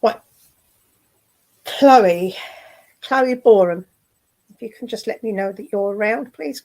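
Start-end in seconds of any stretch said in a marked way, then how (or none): 1.7-3.8 calling the name out loud across the room. Real, not acted. Medium summoning a spirit by name, loud calling out. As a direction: call her name out as if she is far off.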